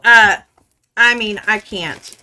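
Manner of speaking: normal talking voice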